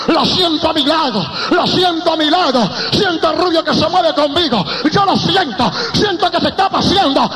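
high, fervent preacher's cry; preaching at full force. Real, not acted. Preacher tells church he feels the presence beside him, fervent preaching.

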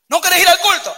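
God mockingly pressing a reluctant churchgoer; God's mock challenge. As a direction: challenge him like a stern God asking twice. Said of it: "Y dice Dios".

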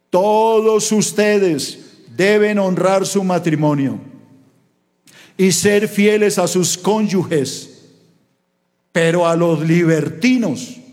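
slow, weighty scripture read; solemn preacher reading scripture; slow verse reading. Acted. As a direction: read the verse slowly, letting each line land. Preacher reads verse slowly.

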